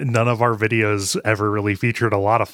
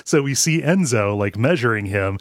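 ordinary delivery

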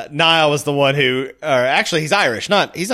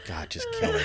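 exasperated Luther; 13 dB quieter than usual. Retold or imitated. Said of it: "Luther's just like".

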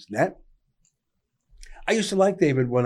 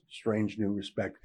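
dry sardonic aside; 5 semitones lower, 9 dB quieter than usual. Real, not acted. Sardonic refrain after example.

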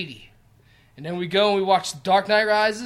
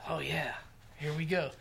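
whispered aside; 4 semitones lower, 14 dB quieter than usual. Retold or imitated. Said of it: "we're like".

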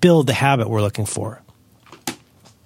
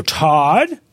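hammed-up scolding parent, pitched way up; exasperated parent; 8 semitones higher, much slower than usual. Parent scolding Todd off the jungle gym, high mock yell. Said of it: "And you go".

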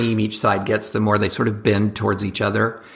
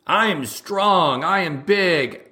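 slow, higher boasting strongman; mock strongman; 6 semitones higher, slower than usual. Parodying the right, a slow mock strongman boast. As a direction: drag out a puffed-up boast. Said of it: "it's this sort of like".

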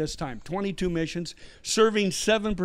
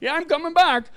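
high, loud, playful call; exuberant; 10 semitones higher, 6 dB louder than usual. Acted. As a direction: call it out gleefully, pitched way up. Jubilant joke about returning, laughter.

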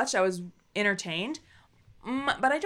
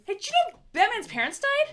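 much higher, quick, faux-helpful voice; mock reminder; 13 semitones higher, faster than usual. Imitating the show reminding viewers about Batman, mocking high voice. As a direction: pipe up as if breaking big news. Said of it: "they find a way to remind you".

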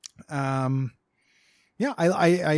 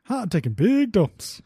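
big dumb guy voice; 3 semitones lower than usual. Pretend. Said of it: "my big dumb guy voice".

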